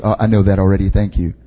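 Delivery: low, offhand brush-off; dismissive. Retold or imitated. Preacher mimicking his response to "God loves you", dismissive. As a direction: wave it away as old news, voice dropped. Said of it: "immediately gets rejected".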